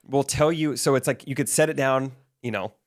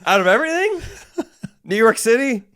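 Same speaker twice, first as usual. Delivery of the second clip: mock disbelief; 10 semitones higher, 5 dB louder than usual. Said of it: "they're like".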